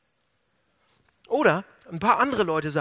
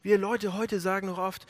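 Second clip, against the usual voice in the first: ordinary delivery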